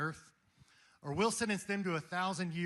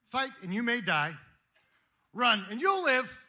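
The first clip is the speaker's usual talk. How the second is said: loud Scottish battle oratory; Scottish accent, Braveheart; 6 semitones higher, 9 dB louder than usual. Acted. Speaker as Wallace addressing his army, loud Scottish warning. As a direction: warn the troops in a ringing Scottish voice. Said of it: "Scottish accent".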